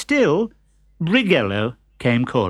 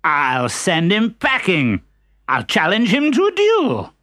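brave declaration; 6 semitones higher, 3 dB louder than usual. Acted. Bold challenge.

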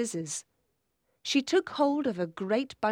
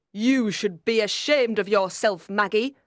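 louder, reproachful aunt; indignant telling-off; stern scolding; 6 dB louder than usual. A narrator's reading. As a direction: shame the child firmly. Aunt scolds Maggie's haircut.

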